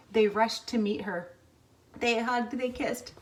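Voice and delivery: ordinary delivery, nothing marked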